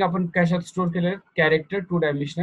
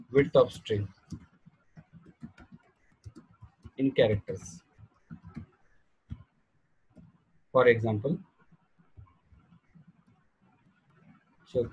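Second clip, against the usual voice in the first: slow dictation with long pauses for students to write; 5 semitones lower, 5 dB quieter, much slower than usual. Real, not acted. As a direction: read each phrase slowly for note-taking. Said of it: "चला लिहून काढा". (सावकाश लिहून घेण्यासाठी सांगणे)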